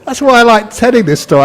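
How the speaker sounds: ordinary talk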